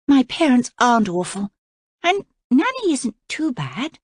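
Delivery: put-on high curious girl's question; young princess. A narrator's reading. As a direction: lift it up like a wide-eyed girl. Voices the princess.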